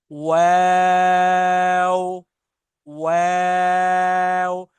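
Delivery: वाव drawn out very long, lips rounded then spread; rounded-lip letter. Shown as a demonstration. Teacher modelling the rounded-lip letter, slow. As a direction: hold the letter out slowly. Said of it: "इसमें ये ज़हन में रखें".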